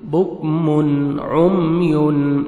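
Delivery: slow, drawn-out melodic tarteel; Quran recitation. Shown as a demonstration. Tajweed teacher reciting a verse for students, melodic and slow. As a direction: chant each syllable, stretching the vowels.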